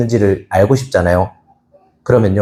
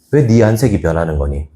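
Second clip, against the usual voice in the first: lower, tender calling of a name; gentle call; 8 semitones lower than usual. Retold or imitated. God asks gently. (부드럽게 부르기)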